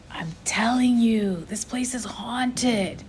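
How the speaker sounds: hushed, slow, breathless whisper; scared whisper. A narrator's reading. A frightened boy whispers to his friend in the graveyard, slow whisper. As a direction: whisper it slowly, afraid of ghosts. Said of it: "came another boy's voice".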